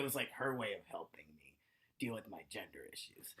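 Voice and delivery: whispered confiding aside. Real, not acted. Comic confiding to the audience, whispered aside. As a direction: lean in and whisper it.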